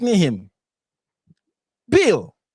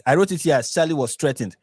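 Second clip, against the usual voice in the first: ordinary talk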